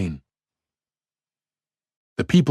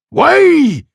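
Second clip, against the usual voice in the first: much higher, louder cry; cried out; 9 dB louder than usual. A narrator's reading. Men around him crying out to clear the road, loud cry. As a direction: shout to clear a path. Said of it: "cried the men all about him".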